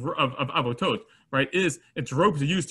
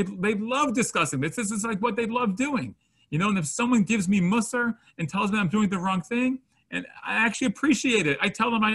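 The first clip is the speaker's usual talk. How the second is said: high, animated, insistent reassurance; eager explaining; 7 semitones higher than usual. Retold or imitated. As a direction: talk them down, all enthusiasm. Insider explains the friends' love.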